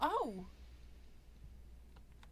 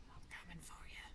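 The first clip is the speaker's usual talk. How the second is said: soft, unvoiced "I'm coming for you"; a horror-movie whisper; creepy whisper; 8 semitones lower, 19 dB quieter than usual. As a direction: breathe the threat into her ear. Whispered threat.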